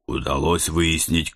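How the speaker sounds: ordinary delivery, nothing marked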